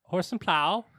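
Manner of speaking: tentative British accent. Shown as a demonstration. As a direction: attempt a British accent on request. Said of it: "in your British accent".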